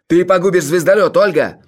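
loud, high desperate shout. A narrator's reading. Leonid warns Olga of ruin, shouting. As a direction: yell an accusation, nerves gone. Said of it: "закричал он".

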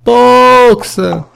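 very high, loud repeated calling; desperate screams. A narrator's reading. Clover running after the truck, screaming desperately. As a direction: cry out his name again and again. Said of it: "țipă ea".

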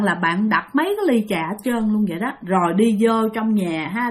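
usual speaking voice

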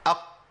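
"أق" with the qaf held shut, stopped at the back of the tongue; qaf with sukun. Shown as a demonstration. Teacher showing learners a sukun qaf, clean stop. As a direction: stop the sound right at the qaf of "أق". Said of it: "قول كده".

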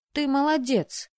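little miss know-it-all; patronizing. A narrator's reading. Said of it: "покровительственно сказала девчушка".

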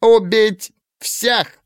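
very high, slow squeaky battle cry; furious squeak. Acted. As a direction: squeak it out in fury. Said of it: "яростно пропищал".

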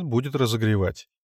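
plain reading voice

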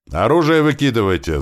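high, loud shouted order; urgent acted yell. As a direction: bellow the order across. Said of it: "заорал грач".

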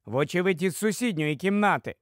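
usual speaking voice

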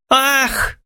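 high mock-feminine cry; swooning exclamation. A narrator's reading. Maria Petrovna faints after rejection, exclaiming. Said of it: "воскликнула Марья Петровна".

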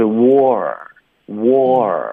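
"war" said very slowly, twice, with a long r-colouring; r-coloured vowel. Shown as a demonstration. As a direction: stretch each "war" out, curling into the R. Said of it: "带一个儿化音啊".

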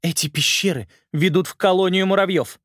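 loud, high angry bark; barking angrily. A narrator's reading. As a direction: snap it out at them. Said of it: "рявкнула она".

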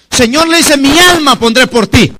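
loud, high, emphatic declaration; bold vow. Acted. Peter vows to the Lord to give his soul, bold and loud. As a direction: proclaim it with fervor.

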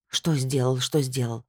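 low, subdued grumbling echo; dropped-pitch, tired repetition; weary echo. A narrator's reading. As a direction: repeat the question back, low and resigned. Weary echo of friend's question.

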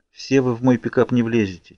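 ordinary delivery

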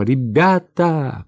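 slow rallying cry to the men; calling out. A narrator's reading. Officer to his soldiers, calling out. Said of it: "крикнул он солдатам".

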